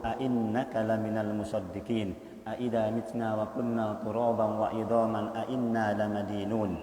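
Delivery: slow, soft, melodic Quranic chant; quran recitation. Acted. Preacher reciting verses to the congregation, chanted. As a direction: recite the verses in a low sung tone.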